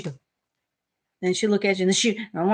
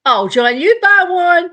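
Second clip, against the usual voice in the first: calling out; 10 semitones higher, 11 dB louder, slower than usual. Real, not acted. Calling to husband.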